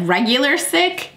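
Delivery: ordinary delivery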